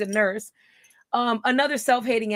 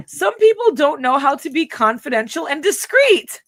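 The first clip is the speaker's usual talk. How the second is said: mocking him; 4 semitones higher, 7 dB louder than usual. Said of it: "Oh, look, he says".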